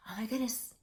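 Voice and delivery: plain reading voice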